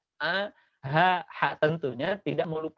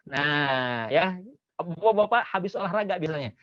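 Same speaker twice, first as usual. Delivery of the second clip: normal talking voice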